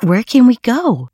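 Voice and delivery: put-on high, eager child's question; exclaiming. A narrator's reading. PJ to Taffy about the magical blanket, exclaiming. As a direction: ask it high, ready for adventure. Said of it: "he exclaimed".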